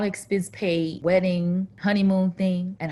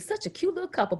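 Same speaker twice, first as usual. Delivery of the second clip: normal talking voice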